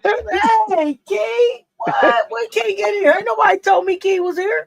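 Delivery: hammed-up shock, like he just looked up from the TV; mock surprised greeting. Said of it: "You said that like you looked up".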